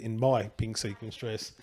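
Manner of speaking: ordinary talk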